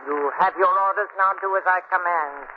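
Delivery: cold, commanding mistress giving orders; a domineering plantation owner binding a slave; Missus Nesbit's voice. Acted. Mimic channels the owner commanding her slave, cold and imperious. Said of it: "It's Missus Nesbit's voice".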